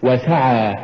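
"وسعى" as wa-sa'a, every vowel given full weight; correct وسعى. Shown as a demonstration. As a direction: say وسعى evenly and carefully. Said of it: "قل".

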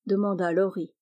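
plain reading voice

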